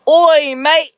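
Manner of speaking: loud, high-pitched British "oi, mate"; hammed-up British greeting on request; British accent. Shown as a demonstration. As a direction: shout it in a cheeky put-on British accent. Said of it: "can you speak with a British accent".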